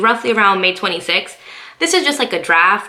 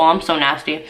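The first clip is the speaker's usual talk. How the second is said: usual speaking voice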